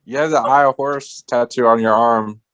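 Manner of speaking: ordinary talk, nothing marked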